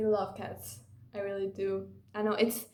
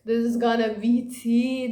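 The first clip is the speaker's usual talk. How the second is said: louder, put-on hyped voice; 8 dB louder than usual. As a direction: sell it like juicy gossip. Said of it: "I was like".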